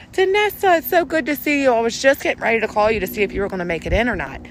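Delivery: high, loud, fast gushing welcome; fake sweet greeting. Coworker greets Danessa after talking trash, sugary fake delight. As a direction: gush like you weren't just gossiping.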